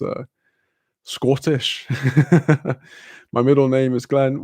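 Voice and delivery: ordinary talk, nothing marked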